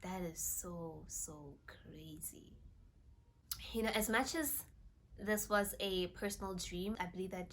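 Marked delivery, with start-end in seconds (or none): 0.0-2.5 slow, awestruck whisper to God; awed whisper. Retold or imitated. Vlogger reliving her awe at Jesus's confirmation, soft whisper. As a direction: breathe the words in quiet amazement. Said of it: "I was just like".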